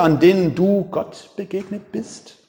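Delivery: normal talking voice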